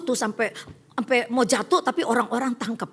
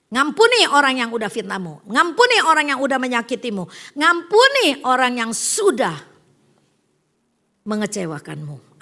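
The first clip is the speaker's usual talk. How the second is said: fervent preaching; 6 dB louder than usual. Real, not acted. Preaching at full force.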